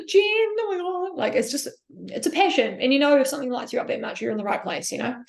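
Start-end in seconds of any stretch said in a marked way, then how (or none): 0.1-1.2 high, slow, overwhelmed; awed exclamation. Retold or imitated. Retelling watching Olympia, awed and high. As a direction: breathe it out in awe, pitched up. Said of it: "I was like".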